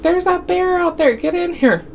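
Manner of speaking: high, urgent frightened plea; frightened mom. Retold or imitated. Mom calling the kids into the camper, high frightened urgency. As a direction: pitch up, hurry them inside. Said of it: "My mom's like".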